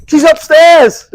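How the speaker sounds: high, loud, drawn-out call; mock obliging shout. Truth-teller giving Anne Frank away to Nazis, mock loud call. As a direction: call it out absurdly to those at the door.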